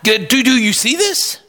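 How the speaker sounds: high, incredulous plea to God; Habakkuk crying out. Retold or imitated. Prophet Habakkuk complains to God, high incredulous plea. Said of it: "He's crying out to God".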